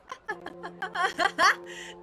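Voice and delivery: high burst of laughter; sudden real laughing fit; amused laugh. Real, not acted. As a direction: crack up in a high giggle. Laughs at absurd chat message.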